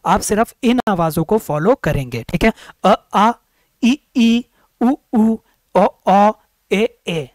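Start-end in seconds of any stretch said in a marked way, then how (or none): 2.2-7.3 अ, आ, ई, उ, ओ, ए each sounded out clearly; vowel sounds demo. Shown as a demonstration. Teacher repeating vowel sounds to class. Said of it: "ये आवाज़ें आपको देखनी है".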